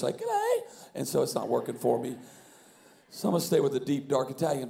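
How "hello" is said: much higher mock English hello; a quick jokey English accent, pitched way up; English accent hammed up, much higher. Shown as a demonstration. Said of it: "trying to be English".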